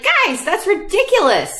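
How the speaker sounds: put-on as if rolling your eyes at the kids; exasperated scolding. Pastor telling the squabbling kids off, mock exasperated. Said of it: "I would be like".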